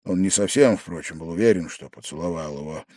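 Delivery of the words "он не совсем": usual speaking voice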